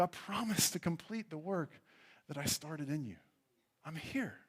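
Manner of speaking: soft, tender promise; gentle, hushed comfort; Jesus reassuring. Retold or imitated. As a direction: quietly remind them they are safe. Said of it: "reminds us".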